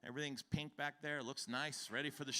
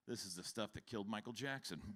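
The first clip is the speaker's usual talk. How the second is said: low creepy aside; 8 semitones lower, 8 dB quieter than usual. Retold or imitated. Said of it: "and goes".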